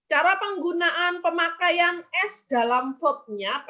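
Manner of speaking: ordinary delivery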